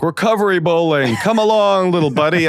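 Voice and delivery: high, hammed-up old-timer invitation; imitated Vietnam vet. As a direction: play a vet rallying a youngster. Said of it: "a Vietnam vet going".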